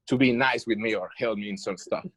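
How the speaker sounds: ordinary talk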